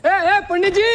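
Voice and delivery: calling out. Acted. Neighbour hailing the Pandit close by, calling out. Same call heard close up.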